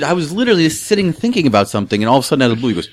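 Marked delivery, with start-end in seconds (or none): none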